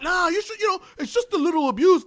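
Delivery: very high, put-on minimising voice; imitating a breezy excuse-maker; mock dismissive. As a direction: brush it off as nothing. Voices people who minimise abuse.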